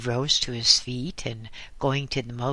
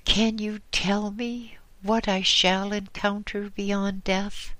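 tremulous; 8 semitones higher than usual. Acted. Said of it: "she asked in a tremulous voice".

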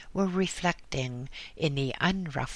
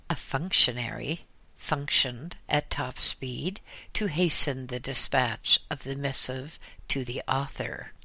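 ordinary delivery

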